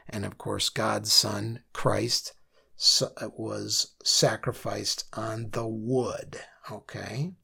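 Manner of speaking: hushed whisper. Real, not acted. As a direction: drop to a reverent whisper. Solemn point about Christ's sacrifice.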